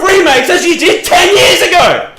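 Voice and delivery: high, slow laughing yell; laughing shout. Real, not acted. Streamer ending his rant with a laughing shout. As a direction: bellow it out through laughter.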